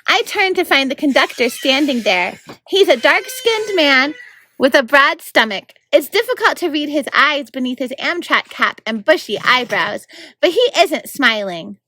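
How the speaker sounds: lively read-aloud narration; story narration. A narrator's reading. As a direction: read it like a bedtime chapter. Narrator reads chapter aloud.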